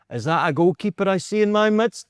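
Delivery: booming greeting. Retold or imitated. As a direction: call it across the room like a showman.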